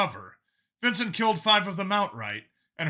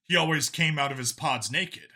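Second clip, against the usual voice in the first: plain reading voice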